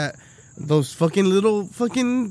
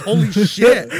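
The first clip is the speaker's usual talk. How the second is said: Holy shit! said through a laugh, louder; laughing exclaim; 7 dB louder, slower than usual. Retold or imitated. Cracking up at the sight, Holy shit! laughing.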